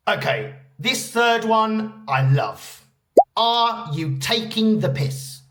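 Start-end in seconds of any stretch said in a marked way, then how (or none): 3.4-5.4 slow, measured delivery of the phrase; slow model phrase. Shown as a demonstration. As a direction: present it slowly for learners. Introduces the phrase for learners.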